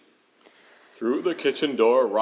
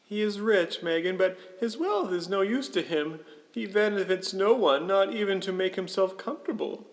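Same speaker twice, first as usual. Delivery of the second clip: high-pitched character voice; disapproving husband; 8 semitones higher than usual. Acted. Husband replying to Megan about Scrooge, high-voiced. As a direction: play the husband, high and matter-of-fact.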